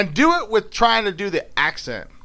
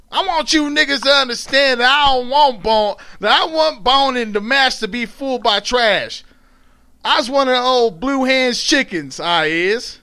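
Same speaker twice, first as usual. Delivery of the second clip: higher-pitched scolding in Southern dialect; mad mother; 8 semitones higher, 4 dB louder than usual. Acted. Mother putting back-talkers in their place, acted anger. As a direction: act out a mother telling them off. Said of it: "when her mom gets mad".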